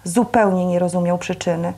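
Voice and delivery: ordinary delivery, nothing marked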